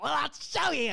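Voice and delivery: loud, high, hammed-up demon cry; big cartoon villain announcement. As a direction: belt it out as a showy demon. Demon's answer acted out.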